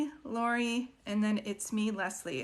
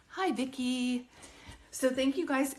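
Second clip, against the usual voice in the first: ordinary talk, nothing marked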